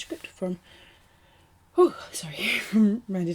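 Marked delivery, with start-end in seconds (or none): 1.7-3.3 breathless, laughing, whispery; gasping through the words; out of breath. Real, not acted. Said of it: "I'm totally out of breath".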